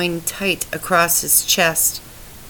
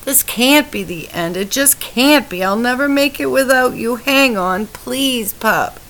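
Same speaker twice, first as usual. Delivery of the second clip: higher, pleading, fast in the middle; desperate pleading; 6 semitones higher than usual. A narrator's reading. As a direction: beg him not to go. Wife begs husband to hang on.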